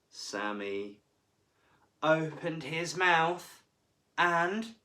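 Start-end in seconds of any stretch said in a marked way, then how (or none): 2.0-4.8 slow, rising suspense build. A narrator's reading. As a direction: draw it out before the bite.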